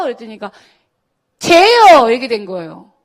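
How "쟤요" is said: loud, high shout of "쟤요"; kids shouting. Retold or imitated. 반 아이들이 선생님께 한꺼번에 외치듯, 크게 소리치며. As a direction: yell it out like a whole class pointing.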